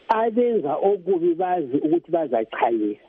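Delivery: usual speaking voice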